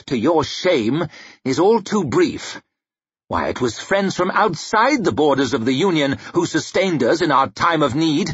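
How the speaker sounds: confident king's speech; easy confidence. A narrator's reading. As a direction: address the chamber with assurance. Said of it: "voice washing around the room with easy confidence".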